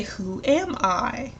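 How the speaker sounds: slow, drawn-out riddle; slow riddle question. Shown as a demonstration. Teacher posing the riddle card to a student, slow. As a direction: stretch it out as a guessing game. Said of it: "But I'm gonna say".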